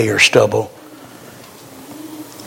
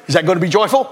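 normal talking voice

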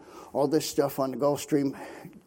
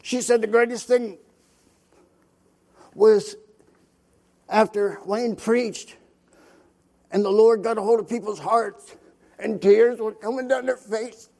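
higher, louder impassioned preaching; fervent preaching; 7 semitones higher, 4 dB louder than usual. Acted. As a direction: let the testimony swell to a climax. Preaching climax about mission fruit.

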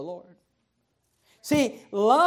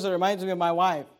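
ordinary talk

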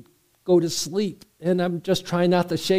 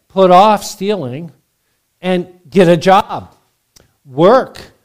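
forceful preaching; 2 semitones higher, 7 dB louder, slower than usual. Real, not acted. Preacher drives home commands.

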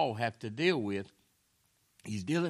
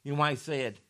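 ordinary talk

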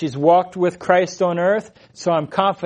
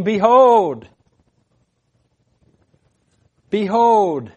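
higher, drawn-out proclamation; raised voice, stretching the word out; loud voice; 4 semitones higher, 4 dB louder, slower than usual. Acted. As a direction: call it out like a herald from a throne. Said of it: "he hears this loud voice".

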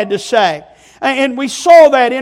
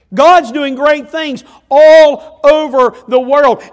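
slow, louder declaration; emphatic proclamation; 5 dB louder, slower than usual. Real, not acted. Preacher proclaiming to congregation, slow and emphatic. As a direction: drive each word home slowly.

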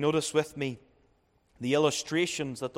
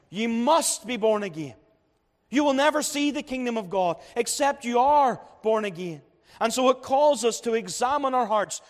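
raised, pressing pulpit voice; fervent preaching; 6 semitones higher, 4 dB louder than usual. Acted. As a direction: preach it higher and louder, driving the point home.

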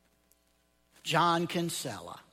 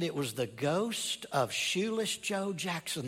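usual speaking voice

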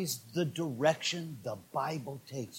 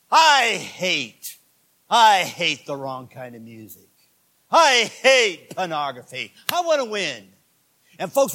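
loud, high sermon shout; full-force preaching; 5 semitones higher, 10 dB louder than usual. Real, not acted. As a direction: thunder it out to the congregation.

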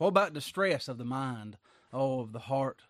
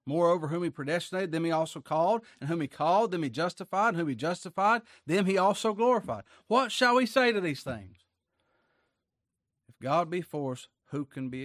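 higher, driving Bible reading; preacher proclaiming a chain of promises; scripture reading; 4 semitones higher, 5 dB louder than usual. Acted. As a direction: build the verse step by step to a loud finish. Reads scripture aloud to congregation.

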